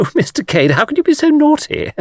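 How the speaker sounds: high, giggly flirtatious scolding; coquettish Miss Taylor. A narrator's reading. Miss Taylor teasing Mr Cade, coquettish. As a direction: play a gushing, flirty admirer. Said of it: "cried Miss Taylor coquettishly".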